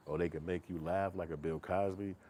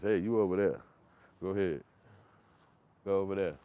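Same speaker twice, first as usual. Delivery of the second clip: put-on louder, firm call to a child; shooing a kid; 7 dB louder than usual. Man shooing a child away, loud and firm. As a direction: wave the kid off loudly.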